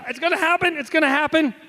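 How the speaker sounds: high, pained, insisting he's fine; strained tough-guy voice. Retold or imitated. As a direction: grit through the pain. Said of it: "He said".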